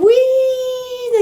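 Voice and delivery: high-pitched drawn-out whee; giddy, long high whee; gleeful squeal. Retold or imitated. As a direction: squeal it like you're being spun around. Recalls being spun on a shoulder.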